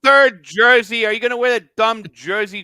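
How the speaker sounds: loud, high taunting jeers; mock heckling. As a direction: jeer at him about the jersey. Razzing about the jersey.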